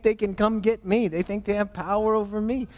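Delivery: high, derisive hoot easing into scoffing; mocking. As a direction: jeer at puny challengers. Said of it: "he mocks them".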